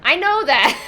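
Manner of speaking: loud, high, laughing outburst; laughing protest. Real, not acted. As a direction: protest loudly through laughter. Laughing protest at being corrected.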